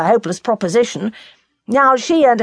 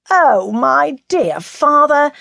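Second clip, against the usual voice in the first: bursting into laughter; 7 semitones higher, much slower than usual. A narrator's reading. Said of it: "and burst out laughing".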